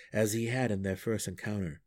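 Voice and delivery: plain reading voice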